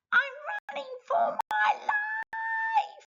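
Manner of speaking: very high squeaky bug voice, fleeing; a tiny ladybird flees a big foot, very high squeak. Acted. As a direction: squeak it out like a panicked little ladybird.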